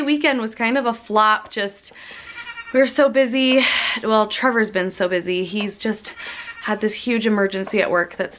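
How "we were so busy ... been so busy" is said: normal talking voice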